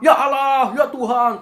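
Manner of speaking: high, loud plea to God; imitated frightened cries. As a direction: mimic scared passengers crying out. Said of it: "takut kan, semua orang takut".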